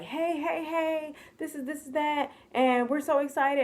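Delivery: high, bubbly imitation of a whole family; acted-out gushing hello; excited greeting. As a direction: pitch it up like an eager welcome. Acting family's video-chat greeting.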